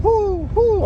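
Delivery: high hooting owl call; eerie owl hoots. Acted. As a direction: hoo like an owl in the dark. Owl sound repeated in story.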